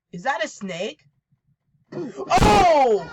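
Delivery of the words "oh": startled yelp. Real, not acted. Jump scare reaction.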